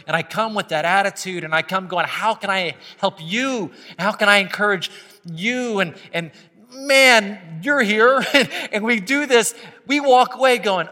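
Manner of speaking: high, loud, fervent preaching; preaching at full force. Acted. Pastor urging the church to gather, fervent loud preaching. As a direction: lift the congregation, pressing hard.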